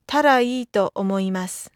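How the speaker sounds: ordinary delivery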